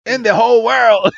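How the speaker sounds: gushing fanboy. Retold or imitated. Cohost chimes in, gushing.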